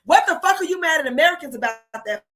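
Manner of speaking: ordinary delivery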